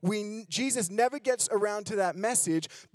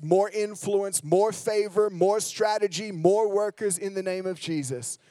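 rising, louder prayer building with each "more"; fervent prayer; 5 dB louder than usual. Acted. Pastor praying over the congregation, fervent and rising. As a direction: pray it out with growing force.

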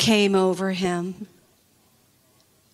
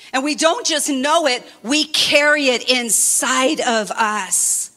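higher, emphatic preaching with slow stretched words; 4 semitones higher, slower than usual. Acted. Pastor urging the congregation, rising passionate preaching. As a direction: lift the room, lean on every promise.

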